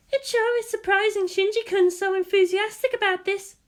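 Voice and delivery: a bright surprised remark; Maya's voice. Acted. Maya commenting to Ritsuko about Shinji, high and light. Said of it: "said Maya".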